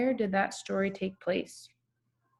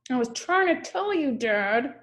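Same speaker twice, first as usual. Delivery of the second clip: put-on high, exasperated kid voice; toddler's complaint; 6 semitones higher than usual. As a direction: whine it like a toddler proven right. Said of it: "She's like".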